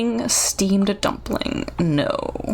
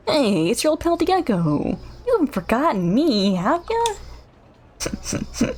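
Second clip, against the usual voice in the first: cheerful character voice; 6 semitones higher than usual. Acted.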